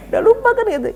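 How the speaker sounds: high laugh running into the words; real giggle at the joke. Real, not acted. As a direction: laugh through the punchline. Laughing at forgetful old men.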